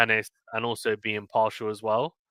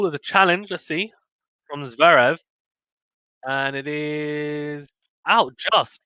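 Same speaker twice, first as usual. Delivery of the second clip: lively, higher tennis commentary, calling each point; excited live sports call; play-by-play; 4 semitones higher, 6 dB louder than usual. Real, not acted. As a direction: call the rally as it happens.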